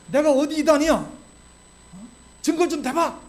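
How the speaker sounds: high, loud mock challenge to an accuser; indignant retort. Preacher acting out the angry reply Paul avoids, indignant and loud. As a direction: fire back defiantly, voice jumping up.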